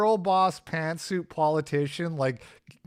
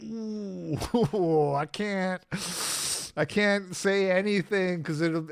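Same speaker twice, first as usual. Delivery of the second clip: higher, laughing mock reluctance; imitated hesitancy; 4 semitones higher, slower than usual. Interviewees asked what Australians look like, hesitant. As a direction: squirm through a reluctant imitated answer. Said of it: "everybody was kinda like".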